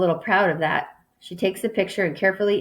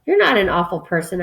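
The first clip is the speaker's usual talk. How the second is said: ordinary delivery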